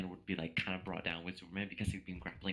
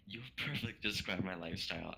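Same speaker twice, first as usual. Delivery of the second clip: usual speaking voice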